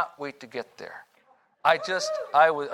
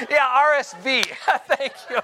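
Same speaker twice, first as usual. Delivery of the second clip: words breaking through real laughter; laughing; 10 semitones higher than usual. Real, not acted. To the church, laughing at his slip of the tongue.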